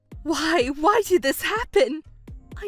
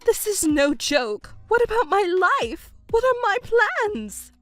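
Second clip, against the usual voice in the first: agitated, self-pitying outburst; panicked protest; 7 semitones higher than usual. Acted. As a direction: demand answers, getting more frantic. Mother panics over her plans.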